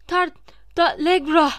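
high, loud spell broken by gasps for air; breathless shout. A narrator's reading. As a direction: force the spell out between breaths. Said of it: "Soluğu kesilerek". (soluk soluğa, kesik kesik bağırış)

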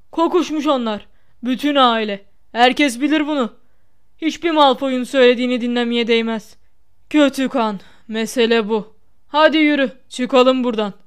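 loud, emphatic character voice; burly protector, scornful and loud; big indignant friend. A narrator's reading. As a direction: denounce them in short forceful bursts.

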